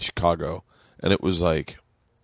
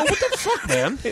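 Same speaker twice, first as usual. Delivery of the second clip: very high, squealed protest; exasperated outburst, re-enacted; retold outrage. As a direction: squawk in comic disbelief. Retold quarrel over cut-off drinks.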